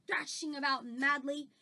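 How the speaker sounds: ordinary delivery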